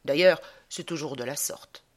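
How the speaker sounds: ordinary talk, nothing marked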